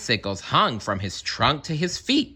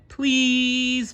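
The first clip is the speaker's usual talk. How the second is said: a high, pleading please; begging bird. A narrator's reading. Bird begging the elephant, pitched high. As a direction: beg like a fussy little bird. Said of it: "begged the bird".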